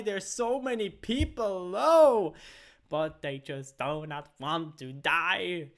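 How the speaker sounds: loud, high esports casting; play-by-play. Acted. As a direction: call the teamfight live. Live play-by-play of teamfight.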